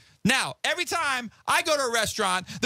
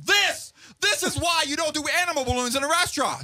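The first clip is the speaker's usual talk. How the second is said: agitated outburst; 7 semitones higher than usual. Retold or imitated. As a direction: blurt it out at the whole table. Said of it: "because I was agitated".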